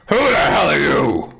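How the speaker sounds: angry orc shout; gruff shout. Acted. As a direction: bark it through the door. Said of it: "a deep voice".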